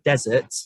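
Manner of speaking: "desert" as DEZ-ert, the sandy kind; noun stressed first syllable. Shown as a demonstration. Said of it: "as in sand".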